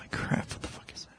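whispered, frustrated aside; muttering to self. Real, not acted. Guest trying to recall a cemetery's location, muttering to self. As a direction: whisper it to yourself while racking your memory. Searching memory for a street name.

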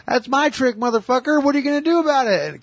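put-on high, fast cocky teen taunt; bratty taunt. Fourteen-year-old taunting the drunk, high fast bratty taunt. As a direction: needle him like a smart-ass kid. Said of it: "He's all".

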